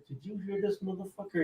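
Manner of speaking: very soft undertone; confidential aside. Retold or imitated. Player to big teammate about the coach, hushed aside. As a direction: slip it quietly to the person next to you.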